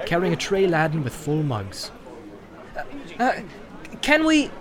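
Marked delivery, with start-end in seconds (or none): 2.8-4.5 higher, halting Frodo voice, unsure how to begin; hesitant hobbit. Acted. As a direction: stumble politely into the question. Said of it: "began Frodo".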